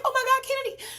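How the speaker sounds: high-pitched frantic exclamation; acted-out alarm; re-enacted panic. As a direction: blurt it out as an overwhelmed mother. Mother's panicked reply.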